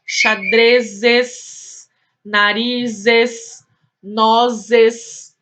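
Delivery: "xadrezes, narizes, nozes" drawn out slowly, the added "es" set apart; stressed plural endings. Shown as a demonstration. Teacher showing pupils the "es" added for plurals, stressed endings. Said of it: "acrescentado o es".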